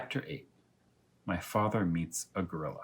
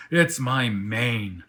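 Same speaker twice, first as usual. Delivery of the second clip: gloomy lion; 6 dB louder, much slower than usual. Acted. Lion glum about mane.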